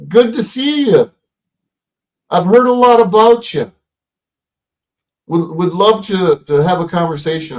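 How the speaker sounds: retold hello in a high put-on voice; high-pitched greeting. Telling Rose he has heard about her, high-pitched greeting. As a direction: pitch the friendly overture way up.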